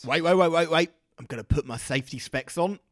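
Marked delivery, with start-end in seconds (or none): 0.1-0.9 high, loud urgent stop; urgent calling. Acted. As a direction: stop him before he fires. Calls a halt before shot.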